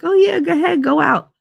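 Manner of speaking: high, breezy mock-permissive mom; easygoing mother. Imagined relaxed mother letting her kid go out, high and breezy. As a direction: imitate a carefree mom, pitched high. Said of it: "be that mother that's like".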